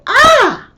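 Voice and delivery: high, loud sudden scream of pain; involuntary cry demo. Shown as a demonstration. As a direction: cry out when hurt. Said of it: "they'll go".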